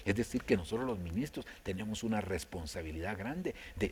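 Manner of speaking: ordinary delivery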